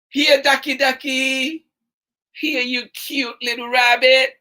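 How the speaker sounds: lively, bouncy storytime read with two bickering voices; reading to children. Acted. Storytime host reading to children, animated and playful. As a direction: play both sides of the argument for the kids. Reads picture book aloud to kids.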